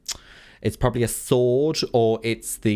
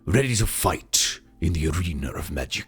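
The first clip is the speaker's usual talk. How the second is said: arena announcer; 2 semitones lower than usual. Pretend. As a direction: announce them into the ring.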